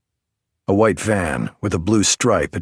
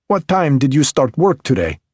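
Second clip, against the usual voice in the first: put-on higher, louder Belarusian-accented woman, sharp and teasing; 8 semitones higher, 5 dB louder than usual. A narrator's reading. M.E. technician Alina needles the detective in Belarusian accent.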